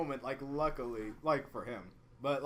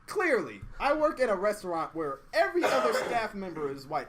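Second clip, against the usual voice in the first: laughing retort; 5 semitones higher, 5 dB louder than usual. Retold or imitated. Sarcastic retort to the customer.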